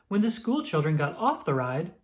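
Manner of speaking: ordinary delivery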